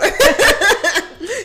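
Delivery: loud high burst of laughter; squealing real laugh. Real, not acted. Laughing at the joke.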